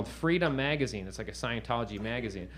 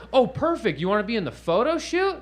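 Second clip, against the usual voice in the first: bright, higher, louder invitation; eager excitement; 9 semitones higher, 6 dB louder than usual. Retold or imitated. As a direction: sell it like great news. Staffer eagerly invites to shoot.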